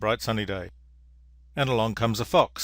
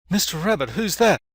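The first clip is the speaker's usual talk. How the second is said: high, loud little bunny voice; high-pitched acted alarm; 7 semitones higher, 5 dB louder than usual. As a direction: blurt the question as a startled storybook bunny. Bunny alarmed at pile of bones.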